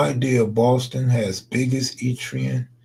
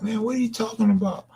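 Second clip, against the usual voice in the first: quick, higher-pitched imitation of a baffled viewer; mock viewer disbelief; 10 semitones higher, faster than usual. Imagining how viewers would react, a quick, high, baffled imitation. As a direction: rattle it off fast like a confused fan. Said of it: "Y'all would have been like".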